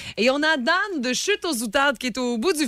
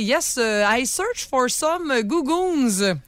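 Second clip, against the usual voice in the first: put-on slow, halting franglais with a French accent; a mild imitation of a Franglais tourist asking for something; broken English; 2 semitones higher, much slower than usual. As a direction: ask a store clerk slowly in shaky English. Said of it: "elle a demandé à une employée".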